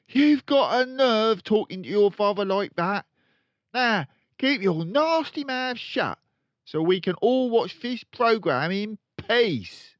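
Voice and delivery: loud, high nagging; scolding mother. Acted. Mother scolding Matilda, loud and high. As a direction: tell her off sharply.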